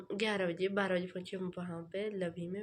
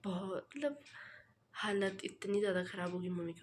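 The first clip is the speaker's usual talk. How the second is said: usual speaking voice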